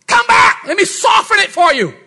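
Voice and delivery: high, loud pleading cry; mock pleading shout. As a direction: beg him back, loud and high. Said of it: "Jesus never said".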